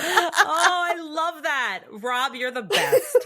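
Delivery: high, loud, laughing delight; real delight, laughing through it; thrilled, slowing down and laughing. Real, not acted. Delighted reaction to Rob's message.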